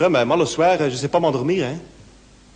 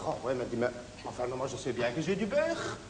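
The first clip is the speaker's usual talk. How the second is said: much softer, lower, sheepish; meek mumble; 4 semitones lower, 12 dB quieter than usual. Acted. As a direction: mumble it back under the breath. Gilbert's meek answer.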